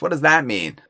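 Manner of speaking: normal talking voice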